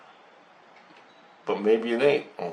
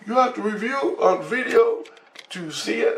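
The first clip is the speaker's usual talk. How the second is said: much higher, unfamiliar alien voice; high alien question; puzzled alien; 12 semitones higher than usual. Retold or imitated. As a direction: ask high like a confused alien.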